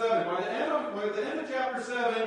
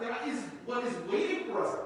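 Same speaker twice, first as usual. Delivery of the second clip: ordinary delivery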